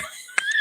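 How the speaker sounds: quick high burst of laughter; short laugh. Real, not acted. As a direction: laugh at your own joke. Laughs at own mermaid joke.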